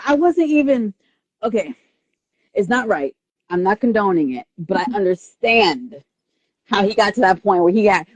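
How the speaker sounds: normal talking voice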